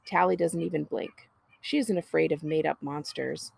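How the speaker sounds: ordinary delivery, nothing marked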